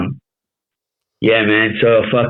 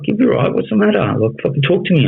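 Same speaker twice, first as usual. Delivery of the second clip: much higher-pitched imitation of his missus; retold concerned missus; 9 semitones higher than usual. Missus checking on her pacing partner, high concerned voice. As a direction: imitate her urging him to talk, pitched way up. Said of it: "She's like".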